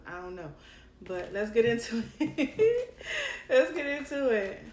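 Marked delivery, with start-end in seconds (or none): 1.3-4.6 1 laughing. Real, not acted. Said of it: "laughing restart".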